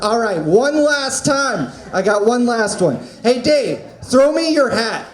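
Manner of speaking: ordinary delivery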